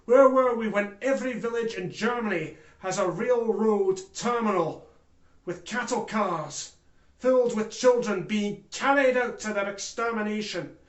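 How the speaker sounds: forceful, indignant courtroom outcry; impassioned accusation. Acted. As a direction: hammer each WHERE WERE WE. Impassioned accusation of himself and nation.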